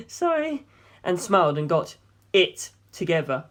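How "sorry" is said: put-on high, pleading young woman's voice; lifted female voice, anxious and imploring. A narrator's reading. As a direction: voice a distraught heiress begging for help. Darlington apologizes.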